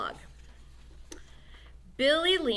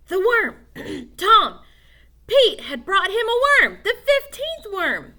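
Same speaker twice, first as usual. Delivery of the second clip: much higher and louder, thrilled; excited; 9 semitones higher, 9 dB louder, slower than usual. A narrator's reading. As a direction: cry out the rescue. The saving worm arrives.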